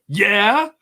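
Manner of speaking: high-pitched combative comeback; challenging. Retold or imitated. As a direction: imitate someone answering back, ready for a fight. Imitates her answering a yell.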